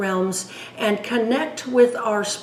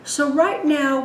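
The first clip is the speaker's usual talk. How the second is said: ordinary delivery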